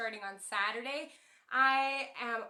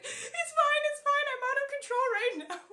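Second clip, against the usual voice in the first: very high, loud mock-tearful squeal; hammed-up weepy wail, insisting through tears; inconsolable crying; 12 semitones higher, 7 dB louder than usual. Pregnant woman in tears to her baffled partner, mock inconsolable wail. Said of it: "I was just in tears, inconsolable".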